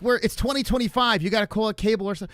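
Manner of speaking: high, fast mocking voice; mocking Matt R. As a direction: parody his know-it-all tone. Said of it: "He's like".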